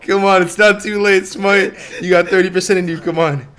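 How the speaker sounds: loud high urging; cheering on a fight. Real, not acted. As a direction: urge the player on, loudly.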